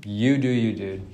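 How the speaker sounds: normal talking voice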